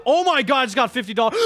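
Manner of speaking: usual speaking voice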